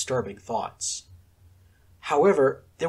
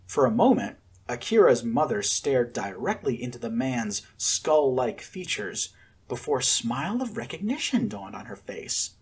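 ordinary delivery, nothing marked

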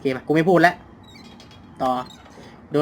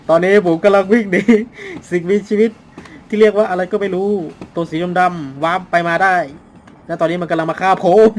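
loud, high, hurried report; louder frantic commentary; breathless running narration; 3 semitones higher, 7 dB louder than usual. Real, not acted. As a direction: narrate while fleeing in panic.

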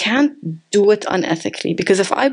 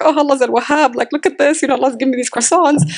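higher, beaming retold delight; happy gush; 5 semitones higher than usual. Preacher retelling her joy at the extra croissant, higher happy voice. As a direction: bubble over with thanks. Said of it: "and I felt so good".

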